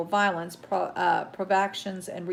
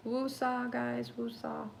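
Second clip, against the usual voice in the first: quieter, drawn-out soothing woosah; real self-calming, softer; softer calming mantra; 5 semitones higher, 7 dB quieter, slower than usual. Real, not acted. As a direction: breathe the anger out gently. Calming herself after rant.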